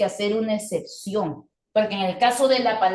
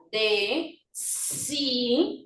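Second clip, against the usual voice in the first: De, si as separate drawn-out syllables; 5 dB quieter than usual. Shown as a demonstration. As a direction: hold De, pause, then si. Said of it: "¿cómo debe quedar?".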